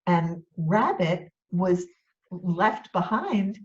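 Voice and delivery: usual speaking voice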